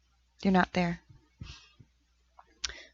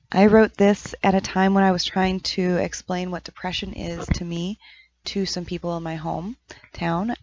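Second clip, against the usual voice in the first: ordinary delivery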